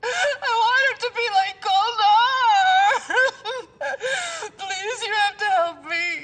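desperate crying plea. Acted. Pleading with Shayda for her baby, crying. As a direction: beg through sobs for the baby.

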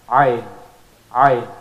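ayn squeezed from the middle of the throat; throaty ayn. Shown as a demonstration. Teacher drilling the ayn sound. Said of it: "That's from the middle of the throat".

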